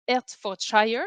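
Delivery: Hertfordshire said the English way, as a Brit would; English accent. Shown as a demonstration. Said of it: "avec mon super accent anglais".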